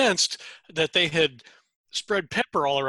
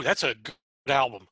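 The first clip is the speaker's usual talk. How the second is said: ordinary delivery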